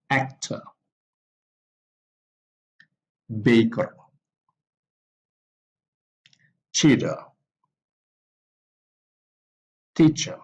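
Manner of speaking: AC-tor, BA-ker, CHEA-ter, TEA-cher, WRI-ter, each stressed at the front; first-syllable stress. Shown as a demonstration. Teacher lists doer nouns, first-syllable stress on actor, baker, cheater, teacher, writer. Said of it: "the stress also in the first syllable".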